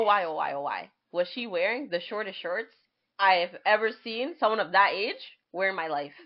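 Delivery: ordinary delivery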